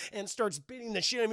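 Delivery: normal talking voice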